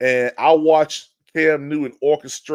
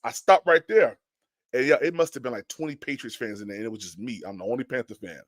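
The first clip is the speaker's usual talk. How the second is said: ordinary delivery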